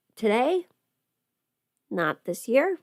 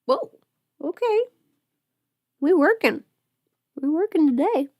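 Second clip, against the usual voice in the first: higher and louder, pumped up; excited; 8 semitones higher, 4 dB louder than usual. Real, not acted. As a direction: cheer yourself on, higher and louder. Excited cheer at makeup progress.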